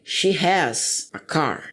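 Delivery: very slow, careful English example; slow model sentence. Shown as a demonstration. Teacher showing students the new form, slow and clear. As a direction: lay each word down slowly for the class. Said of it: "olha só como é que ficaria".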